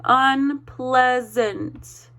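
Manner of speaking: Unpleasant drawn out slowly, syllable by syllable; teacher leads the class in Unpleasant, slowly. Shown as a demonstration. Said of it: "one more time".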